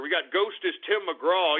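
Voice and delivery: ordinary talk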